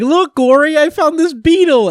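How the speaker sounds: little Panda excitedly shows his brother a beetle; excited little kid. Retold or imitated. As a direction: squeal it like an eager little brother showing off. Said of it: "He's like".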